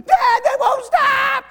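put-on high whining child's cry; whiny daughter. Little girl in the van whining to dad, high and tearful.

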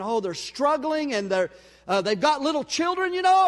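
high, loud hammed-up pity; mock pity. Pastor mocks his own pity for struggling families, hammed-up. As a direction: overdo the sympathy for laughs.

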